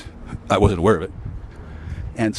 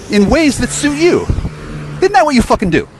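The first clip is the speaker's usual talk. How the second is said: furious shout; 13 semitones higher, 4 dB louder than usual. Real, not acted. Vlogger accuses viewers of twisting his messages, furious high shout. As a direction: hurl the accusation at them.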